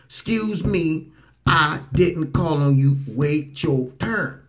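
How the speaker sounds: slow, patient polite firmness. Retold or imitated. Commentator mimics the president telling her to wait, slow and polite. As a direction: spell it out slowly and kindly. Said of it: "How many times I got to tell you".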